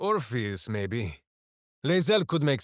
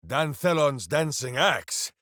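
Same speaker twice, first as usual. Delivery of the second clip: ordinary delivery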